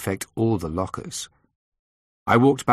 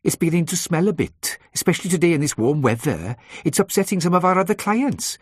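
high, broad Welsh lilt; broad Welsh accent; 10 semitones higher, 3 dB louder than usual. A narrator's reading. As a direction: Welsh receptionist, pitched up. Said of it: "she said in a broad Welsh accent".

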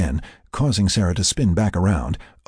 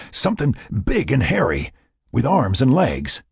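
pitched up, urgent emphasis; insistent explaining; 6 semitones higher than usual. A narrator's reading. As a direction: stress each detail to convince her. Insists on what he saw.

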